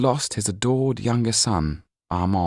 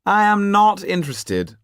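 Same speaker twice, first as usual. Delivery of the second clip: much higher, louder, shrill disdain; dismissive Marquis; 14 semitones higher, 6 dB louder than usual. Acted. Marquis refusing to hear of Paris, high and loud. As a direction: slam the door on the subject.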